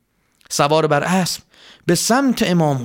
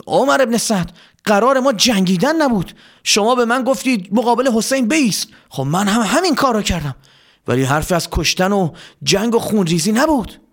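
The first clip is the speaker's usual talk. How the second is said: mildly raised, higher-pitched protest; 7 semitones higher than usual. Acted. Hor answers Omar ibn Saad back, in a mildly raised voice. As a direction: protest aloud, pitch lifted. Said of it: "هر با صدای بلند گفت".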